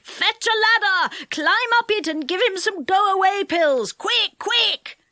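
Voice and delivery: high, urgent mock shouts; yelling. A narrator's reading. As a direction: call out in a hurry. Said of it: "yelling and shouting".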